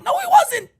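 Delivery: high, loud angry yell; angry shout. Real, not acted. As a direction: shout him down. Host yelling at player on replay.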